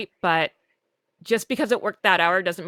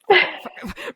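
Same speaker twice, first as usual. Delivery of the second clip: outburst; 9 semitones higher than usual. Retold or imitated. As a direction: let it burst out at last. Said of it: "and I'm mumbling".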